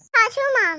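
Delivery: "छाछू माँ" with chh in place of s; lisped try. Acted. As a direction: lisp "छाछू" as chhaa-chhoo. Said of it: "तोतली बहू".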